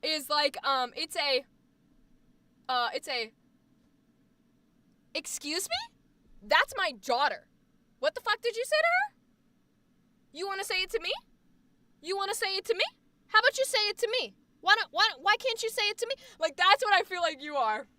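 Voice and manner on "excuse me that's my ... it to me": high, rising confrontational mom voice; young mom scary. Acted. Young mom confronting whoever insulted her daughter, scary protective challenge. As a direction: challenge them sharply, getting faster and higher. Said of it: "A young mom scary".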